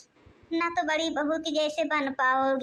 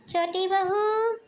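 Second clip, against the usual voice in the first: drawn-out call; 4 semitones higher, much slower than usual. Acted. Mother-in-law summoning her daughter-in-law again with a slow holler. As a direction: draw out बहू, calling into the next room.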